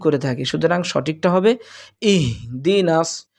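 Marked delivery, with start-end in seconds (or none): 2.1-3.3 ইহদিনাস drawn out slowly; slow recitation. Shown as a demonstration. Teacher modelling ইহদিনাস slowly for students. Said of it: "উচ্চারণটা হবে হা".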